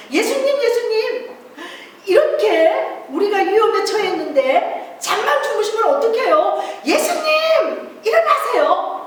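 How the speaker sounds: high urgent pleading calls; disciples crying out. Acted. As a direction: wake the sleeping master in panic. Disciples waking Jesus in storm.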